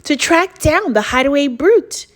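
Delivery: loud, animated picture-book reading; story narration. A narrator's reading. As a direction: punch the rhymes for young listeners. Reads picture book aloud.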